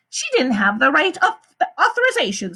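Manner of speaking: a high, smooth woman's voice; the warden. A narrator's reading. The warden explains to the visitors, high and smooth. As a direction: lie calmly to the visitors.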